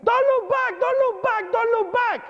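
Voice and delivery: very high, urgent yelled warning; police screaming. Retold or imitated. Police yelling at a man at the tower, high urgent shout. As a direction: call out over and over to stop someone. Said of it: "they start screaming".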